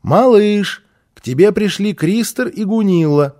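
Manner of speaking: calling loudly from the hallway; mother calling out. A narrator's reading. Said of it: "послышался мамин голос". (мама громко зовёт)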